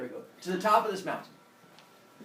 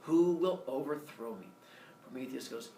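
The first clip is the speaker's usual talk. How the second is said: ordinary delivery, nothing marked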